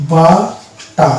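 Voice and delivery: বাটা as ba, pause, ta, no R; British, split syllables. Shown as a demonstration. Teacher dictates butter to students, silent R. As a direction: break বাটা slowly in two, ending on a vowel. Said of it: "not butter".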